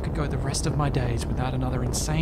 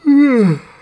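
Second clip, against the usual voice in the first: heavy sigh; 11 semitones higher, 12 dB louder than usual. Real, not acted. Exhausted after escaping pursuers.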